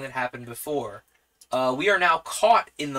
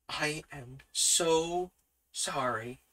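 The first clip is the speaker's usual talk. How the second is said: contrite apology; 3 semitones higher, 5 dB quieter, slower than usual. Acted. Chastened date apologises to him.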